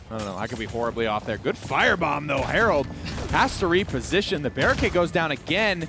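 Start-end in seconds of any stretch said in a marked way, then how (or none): none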